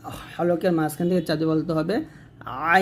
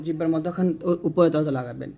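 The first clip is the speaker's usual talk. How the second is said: usual speaking voice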